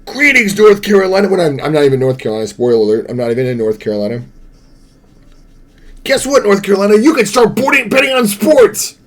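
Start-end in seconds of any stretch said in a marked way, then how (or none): 0.1-1.3 high mock ad greeting; commercial announcer. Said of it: "Because every commercial is". 6.0-9.0 high, excited mock ad read; commercial announcer. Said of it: "Because every commercial is".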